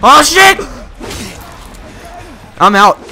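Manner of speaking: sudden high panicked scream; startled scream. Real, not acted. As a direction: yelp in real fright, then bail. Jump scare in horror game.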